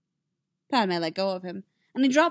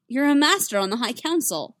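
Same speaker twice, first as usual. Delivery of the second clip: higher, louder objection; raised, urgent argument; incredulous protest; 5 semitones higher, 5 dB louder than usual. A narrator's reading. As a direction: insist on it in disbelief. Anakin protests the resignation.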